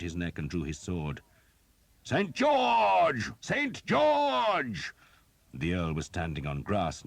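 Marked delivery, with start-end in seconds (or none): 2.0-4.9 high, loud rallying shout; battle cry. A narrator's reading. English fighters rallying in the melee, a loud high cry. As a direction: bellow the saint's name to rally the men.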